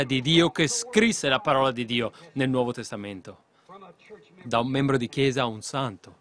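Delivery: loud, driving revival preaching; preaching at full force. Acted. Preacher to the crowd about Paul, thundering preaching. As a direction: hammer it home to the congregation.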